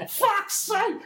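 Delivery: high frustrated outburst; exasperated. Real, not acted. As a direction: blurt it out in exasperation.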